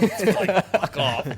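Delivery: loud, laughing snarl of a doctor shoving the patient away; doctor's laughing brush-off. Acted. Doctor shoves the patient's hand away, loud laughing brush-off. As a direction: bark it with a laugh, dismissive. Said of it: "He's like".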